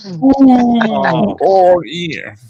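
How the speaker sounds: teasing ooh. Acted. Teasing reaction to callout.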